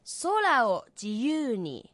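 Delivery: slow, careful line for learners; slow demo read; slow teaching read. Shown as a demonstration. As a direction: read it out slowly for students to copy. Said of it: "我们慢慢地念一遍".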